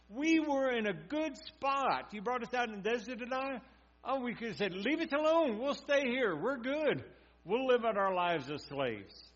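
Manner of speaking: high, panicked wailing at the leader; terrified complaint. Retold or imitated. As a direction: whine it like people sure they'll die. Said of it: "and they're terrified".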